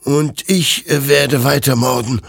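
high, distraught declaration; tearful. Acted. Hector answers the accusation, tearful and high. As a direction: force it out through rising tears. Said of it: "Hector traten Tränen in die Augen".

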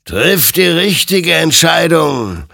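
loud, slow, high command; stern admonition. A narrator's reading. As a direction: admonish with drawn-out force. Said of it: "ermahnte ihn sein Gegenüber". (laute, eindringliche Ermahnung)

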